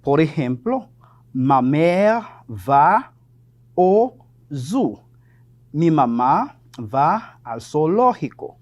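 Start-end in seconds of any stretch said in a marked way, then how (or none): 1.3-5.0 half-speed model, word by word; slow, deliberate teacher's demonstration; slow example sentence. Shown as a demonstration. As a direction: stretch each word for learners.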